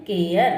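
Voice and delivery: केयर said with the e-a glide, rising; केयर pronounced like English care; teacher demo word. Shown as a demonstration. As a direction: model केयर as "kay-uh". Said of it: "ये आया ना केयर".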